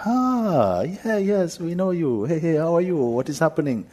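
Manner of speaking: very high, loud delighted greeting; woman squealing hello from the back seat; excited woman. Retold or imitated. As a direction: gush like meeting an old friend.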